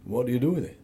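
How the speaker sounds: ordinary talk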